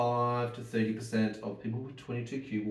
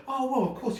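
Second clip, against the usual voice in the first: breezy doctor voice; 12 semitones higher than usual. Retold or imitated. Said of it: "to go".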